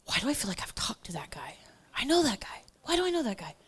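puzzled whisper to herself. Retold or imitated. Whispering to herself while mic'd up, puzzled. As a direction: whisper it so the mic won't catch it. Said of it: "I forget that they're listening to me".

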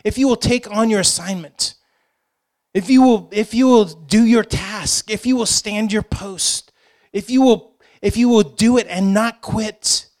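high, emphatic rallying command; military charge. Acted. The Lord giving assignments to his people, military command. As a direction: drive each condition home like marching orders. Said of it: "that's a, that's a military".

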